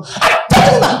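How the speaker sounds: sudden high-pitched outburst of annoyance; hammed-up exasperated squeal; mock irritation. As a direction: imitate a fed-up listener exploding. 더위에 짜증내는 청중 흉내.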